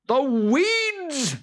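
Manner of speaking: very high, loud, slow Boggart shout. Acted. Boggart shouting across the field to Jude. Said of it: "he shouted".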